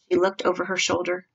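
usual speaking voice

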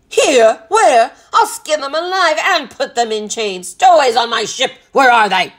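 loud, high roared questions and threats; furious captain. A narrator's reading. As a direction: roar in blustering disbelief. Enraged captain hunts the stowaways.

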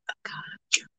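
whispered playful repeat; whispering. Pretend. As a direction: breathe the words out.

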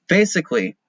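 usual speaking voice

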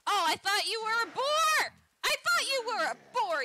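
high, loud startled yell; startled shout. Real, not acted. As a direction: shout in sudden fright. Startled by friend in game.

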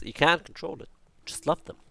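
ordinary talk

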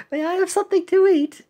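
quick, high-pitched asker; high pleading character voice. Acted. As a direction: plead fast in a squeaky cartoon voice.

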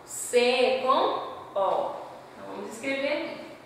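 usual speaking voice

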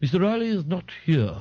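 high, affected Mayfair voice; assumed Mayfair accent. A narrator's reading. Ignatius disguising himself on the telephone, Mayfair accent. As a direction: answer in put-on Mayfair tones. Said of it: "rich with Mayfair accents".

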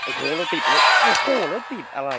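high, loud frantic yelling; panicked scream. Real, not acted. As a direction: scream like you're trapped for real. Trapped again.

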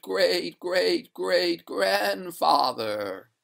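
high, drawn-out spectral voice; stately, wailing spectre; doleful water ghost. Acted. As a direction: intone the lament high, stretching the phrase endings.

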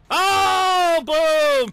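very high, loud whoop; excited shout. Real, not acted. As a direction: yell as the hit explodes. Reacting to a big kill.

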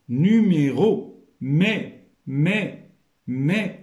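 "Numéro" said out loudly, then "mais" isolated as "mé"; teacher stressing "mais" as a lone rising syllable "mé"; sounded-out syllable. Shown as a demonstration. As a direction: pick out the "mé" of "Numéro" and repeat it. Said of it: "غير من خلال الصوت".

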